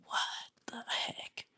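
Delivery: breathless whisper of amazement; awed whisper. Real, not acted. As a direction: whisper it in disbelief. Awed reaction to cover.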